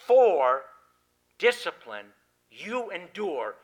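slow, forceful command with a strong stop; emphatic command. Shown as a demonstration. Preacher commanding the congregation, slow and forceful. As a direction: say it as an exclamation, a command. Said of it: "put an exclamation mark on it".

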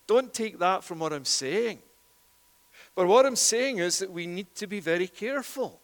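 ordinary delivery

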